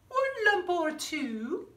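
ordinary delivery, nothing marked

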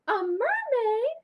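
high, loud, slow puppet surprise; puppet Shimmer surprised. Acted. Shimmer the puppet amazed by the host's choice, high.

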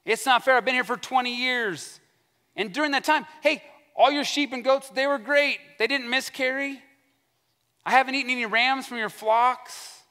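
high-pitched, aggrieved complaint; hammed-up protest in a high voice; mock crying out. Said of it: "He cries out all these things".

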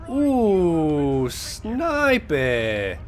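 ordinary talk, nothing marked

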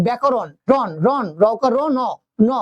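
রন and ন broken out, stopped on n; isolating syllables. Shown as a demonstration. Teacher breaking ব্যাকরণ into syllables for students. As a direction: sound out ন alone, clipped. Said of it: "এই নটা আমরা থেমে যাচ্ছে".

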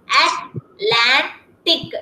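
Atlantic stretched out very slowly; teacher stretching Atlantic for a child; very slow model word. Shown as a demonstration. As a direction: draw Atlantic out syllable by syllable. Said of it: "drawn-out model word".

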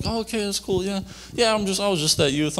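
high, breezy put-on casualness; faked cheerfulness. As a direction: play it cool so mom suspects nothing. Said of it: "I'm all trying to sound, like, good and happy".

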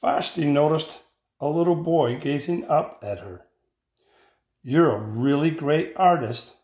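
put-on expressive read-aloud for children; picture-book reading voice; story narration. A narrator's reading. Teacher reads picture book aloud.

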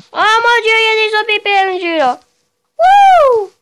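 high, loud, rushed panic; panicked yelling. Real, not acted. Player panicking at zombies, high yell.

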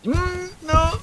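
high panicked whimper of no; a real scared cry; frightened squeal. Real, not acted. Scared while playing horror game.

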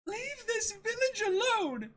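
put-on very high, slow, defiant girl; Kaya, defiant cry. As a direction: stand up to him, pitched high. Kaya defies the villain.

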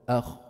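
أخ scraped from the top of the throat; throat letter demo. Shown as a demonstration. Teacher showing the khaa exit, sounding أخ. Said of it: "فوق".

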